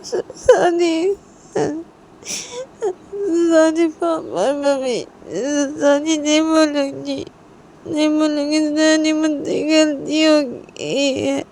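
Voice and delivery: high, wailing mock cry, words dragged out; tearful child. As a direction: play a little girl sobbing out her apology. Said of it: "रोते-रोते". (रोते-रोते, बच्ची की आवाज़)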